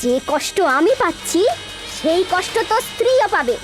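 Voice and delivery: high, fierce cursing vow; vengeful fury. Acted. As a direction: curse him with burning rage. Snake-woman vows vengeance on killer. (ক্রুদ্ধ নাগিনের প্রতিশোধের শপথ)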